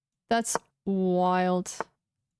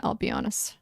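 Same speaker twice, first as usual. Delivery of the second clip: whispered aside; 2 semitones lower, 3 dB quieter than usual. Real, not acted. Muttered aside while playing chess.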